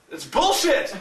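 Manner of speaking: high-pitched comic protest; mock indignation. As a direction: imitate an outraged friend. Acting out friend's protest.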